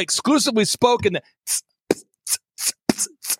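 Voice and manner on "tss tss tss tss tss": soft unvoiced tss hissing sounds; imitated lisp hiss. A comedian mimics a worship leader's hissing esses. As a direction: hiss the s like a lisping singer. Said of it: "you hear that, s-, that sound?".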